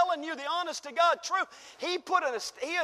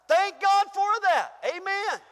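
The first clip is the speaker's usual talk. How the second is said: loud high praise; joyful praise; 6 semitones higher, 7 dB louder than usual. Real, not acted. Pastor praising God before his congregation, loud and high. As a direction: burst out thanking God. Preacher's exclamation.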